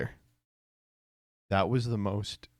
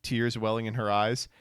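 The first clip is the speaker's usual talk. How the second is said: ordinary delivery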